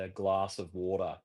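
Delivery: glass of water with a broad a and no r on water; own Australian accent. Shown as a demonstration. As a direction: say it the Aussie way. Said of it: "and I say".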